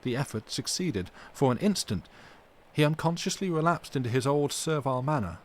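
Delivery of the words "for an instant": plain reading voice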